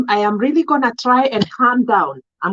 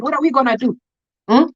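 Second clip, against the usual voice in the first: ordinary talk, nothing marked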